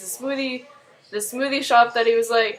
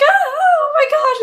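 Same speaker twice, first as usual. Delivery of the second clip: high shrieking startled gasp, much louder; retold woman's frightened scream, hammed up; mock scream; 9 dB louder than usual. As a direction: shriek it like a grabbed woman in a panic. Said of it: "and she starts screaming".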